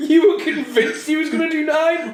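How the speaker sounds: high-pitched, laughing through the words; laughing tease. Real, not acted. Teasing co-host over his German suplex worry, laughing. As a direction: tease him while cracking up.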